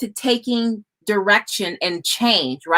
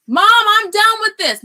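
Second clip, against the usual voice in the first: high, louder mock yell of a child; imitating kid yelling; 10 semitones higher, 4 dB louder than usual. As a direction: play your kid calling from across the house. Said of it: "My kids start to yell".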